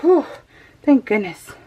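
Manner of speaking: usual speaking voice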